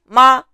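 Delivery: ma high and level; first tone. Shown as a demonstration. Teacher giving the Chinese word for mother, high level tone. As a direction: hold ma on one high pitch. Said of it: "it has to be".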